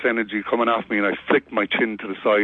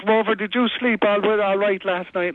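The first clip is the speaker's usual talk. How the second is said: doting dog owner; 10 semitones higher than usual. Retold or imitated. Owner fussing over dog.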